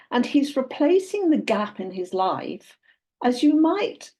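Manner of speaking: ordinary talk, nothing marked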